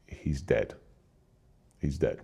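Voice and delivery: low, hushed, grave delivery; grave bad news. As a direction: relay the death softly, voice dropped low. Relaying brother's news of death.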